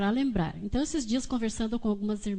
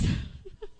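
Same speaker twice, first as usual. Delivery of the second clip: short laugh; 11 semitones higher, 4 dB quieter than usual. Real, not acted. Laughs at the husband's question.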